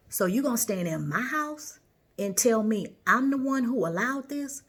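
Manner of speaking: imitating a woman's loud indignant retort; defiant comeback. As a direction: throw her words back like she did. Retold quarrel, woman snaps back.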